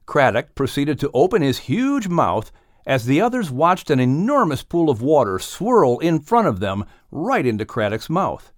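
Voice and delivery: usual speaking voice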